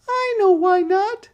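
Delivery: put-on very high, loud little girl; little sister voice. A narrator's reading. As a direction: squeak it like a small stubborn girl. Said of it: "said Sister".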